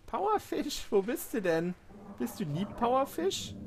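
high sweet-talk to a monster; coaxing pet voice. Pretend. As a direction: coo at it like a pet. Cooing at a dangerous fish. (lockend wie zu einem Haustier)

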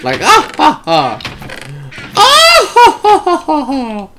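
high, drawn-out demo laugh climbing up; showing off a laugh. Shown as a demonstration. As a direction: prove you can laugh, go way up high. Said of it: "I can laugh".

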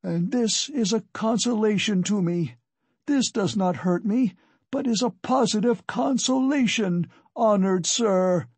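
high-pitched strained call. A narrator's reading. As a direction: call out while being dragged by the hair. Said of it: "he called out".

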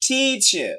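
Teacher with a drawn-out TEE; long first syllable. Shown as a demonstration. Teacher showing a longer stressed syllable, emphatic. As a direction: stretch the first vowel of teacher, then fall. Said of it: "লঙ্গা".

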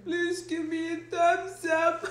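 high, pleading fake whimper; mock crying. Begging stream viewers for a thumbs up, mock crying. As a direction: pretend to tear up while begging. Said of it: "so I don't cry".

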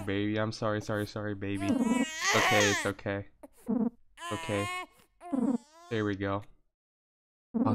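ordinary delivery

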